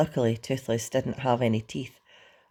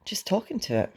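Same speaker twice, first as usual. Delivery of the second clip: embarrassed mumble; 7 semitones higher than usual. A narrator's reading. Hiccup owns up to Fishlegs, embarrassed mumble. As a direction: own up in a small embarrassed voice. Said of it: "mumbled Hiccup, very embarrassed".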